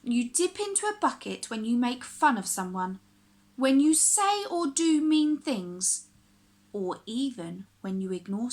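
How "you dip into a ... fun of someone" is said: plain reading voice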